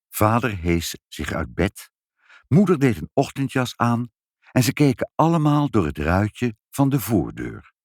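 ordinary delivery, nothing marked

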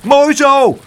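high, loud cheer; jubilant, full-voiced shout. A narrator's reading. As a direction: yell encouragement from the side. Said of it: "schreeuwde Pluk".

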